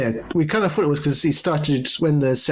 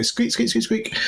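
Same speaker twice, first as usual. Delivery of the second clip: mouse squeak; 10 semitones higher than usual. Retold or imitated. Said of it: "we go".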